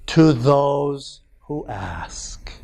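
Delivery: slow, breathy whisper of a secret line; hushed whisper. Acted. Preacher whispering the rarely quoted end of the verse, slow hush. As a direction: let the words out slowly as a secret. Reveals the verse's unquoted ending.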